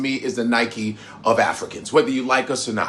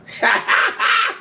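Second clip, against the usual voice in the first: a big high shout of a laugh. Real, not acted. Laughing about the mesh marina.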